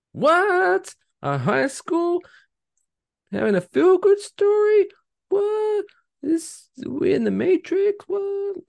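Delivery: very high squeal of disbelief; hammed-up incredulous falsetto; mock astonishment. Host stunned by a high school story, mock astonishment.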